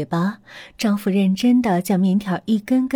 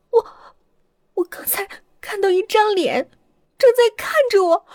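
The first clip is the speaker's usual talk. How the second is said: much higher, stammering and scared; frightened; 13 semitones higher than usual. Acted. Shaken wife tells husband of the face, frightened. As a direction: get it out through fear.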